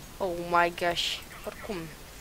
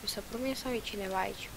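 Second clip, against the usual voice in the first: ordinary delivery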